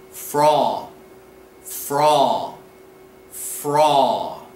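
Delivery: "Frau" said as "fraw", long and falling; spaced, deliberate "Frau", rhyming with "draw"; model pronunciation. Shown as a demonstration. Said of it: "correct pronunciation would be".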